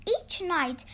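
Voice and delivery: ordinary delivery, nothing marked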